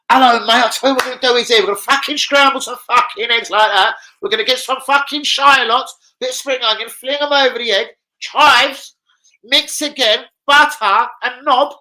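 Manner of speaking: high, loud mock-cockney TV chef; Jamie Oliver. Streamer mocking a chef gone cockney overnight, loud high impression. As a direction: rattle off a recipe in an exaggerated cockney. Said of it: "he just went cockney overnight".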